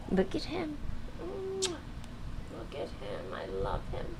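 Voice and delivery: a hushed aside of affection, sinking in pitch; doting on cat. Real, not acted. Host admiring the cat, hushed and doting.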